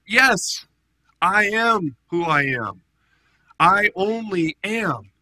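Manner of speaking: Jesus declaring himself before the council; solemn proclamation. Retold or imitated. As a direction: claim the name with full weight. Jesus answering the high priest.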